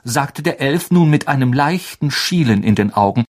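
ordinary delivery, nothing marked

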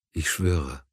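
low, subdued oath; strange-sounding voice. A narrator's reading. Wilhelm swearing to the feverish woman, low estranged voice. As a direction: drop the pitch, as if hardly your own voice. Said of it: "Seine Stimme klang fremd".

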